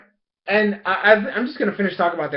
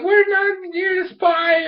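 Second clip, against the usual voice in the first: high, slow, drawn-out denial; girlish protest; 13 semitones higher, slower than usual. Retold or imitated. Ikki answering her captors' questions, high girlish protest. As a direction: protest in a raised pretend-girl voice. Acts captured girl under questioning.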